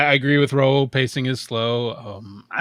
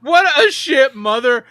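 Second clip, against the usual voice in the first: loud, high exclaim; louder emphatic repeat; 9 semitones higher, 10 dB louder than usual. Real, not acted. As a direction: say it louder and higher.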